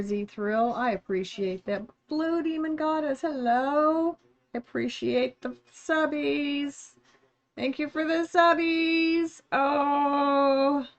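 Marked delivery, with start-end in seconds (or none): 2.1-10.9 excited thanks. Real, not acted. Thanking subscribers on stream.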